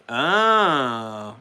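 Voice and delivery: ordinary talk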